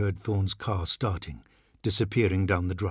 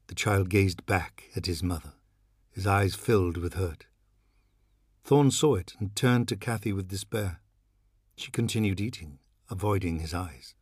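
plain reading voice

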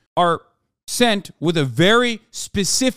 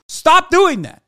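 mock scolding; 12 semitones higher, 7 dB louder, slower than usual. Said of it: "turned around and said".

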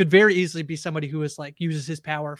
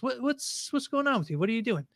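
imitated concerned dad; 7 semitones higher, 5 dB quieter than usual. Dad voiced walking in on son.

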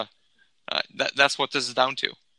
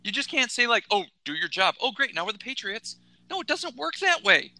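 heated rant; 6 semitones higher than usual. Acted. Continuing the rant.

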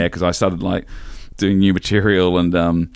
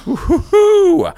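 mock cheer; 5 dB louder, much slower than usual. Mock triumphant opener cheer.

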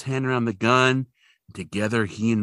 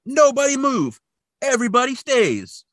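high, barked order from the gunman; hammed-up hostage-taker ordering the room; mock gunman command; 13 semitones higher, 3 dB louder than usual. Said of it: "and he's like".

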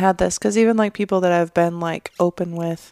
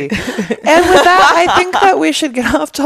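hammy announcer; 7 semitones higher, 5 dB louder, slower than usual. Pretend. Hammy show transition.

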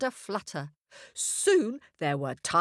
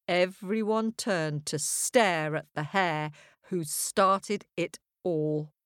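ordinary delivery

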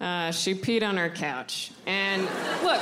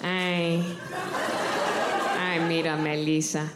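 great-grandmother admiring her granddaughter, lower and fond; great grandma's voice; 5 semitones lower than usual. Retold or imitated. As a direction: croon like a doting old grandmother. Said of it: "that's my great grandma's voice".

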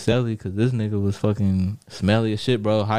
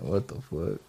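hushed shock; 4 semitones lower, 10 dB quieter, slower than usual. Retold or imitated. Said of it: "So I'm like".

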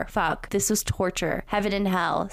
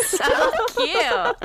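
much higher, loud laughing; 5 dB louder than usual. Real, not acted. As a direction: squeal with laughter. Laughs, comments.

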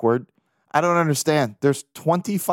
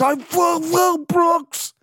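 high, slow, strained crying shout; mock sobbing wail; much slower than usual. Fan sobbing his love for Brooks, slow high wail. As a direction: blubber the words out through tears. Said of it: "he was crying so hard".

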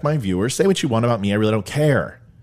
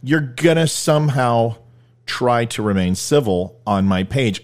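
ordinary delivery

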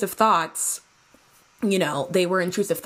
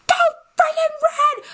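very high, loud squeaky yell; high squeaky cartoon shout in the head; cartoon battle cry; 6 dB louder than usual. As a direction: scream it like a frantic cartoon. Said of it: "They're like".

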